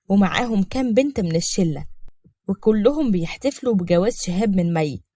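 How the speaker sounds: usual speaking voice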